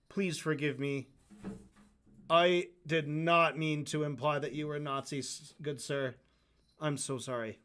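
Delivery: low, soft begging for forgiveness; mock groveling. Host acting out a groveling apology to good sir, mock groveling. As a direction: sink into a hushed, abject apology. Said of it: "groveling would imply, like".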